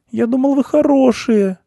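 very high, loud hurt squeak; wounded little mouse; plaintive mouse. A narrator's reading. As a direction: reproach them in a tearful little voice. Weeping mouse reproaches the cats.

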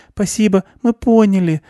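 put-on high little girl's voice, interrupting; girl cutting in. A narrator's reading. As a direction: cut him off in a high child's voice. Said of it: "перебила его Аня".

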